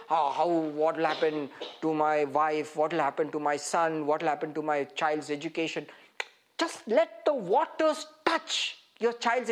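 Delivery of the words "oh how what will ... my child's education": mock worried. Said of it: "It's like".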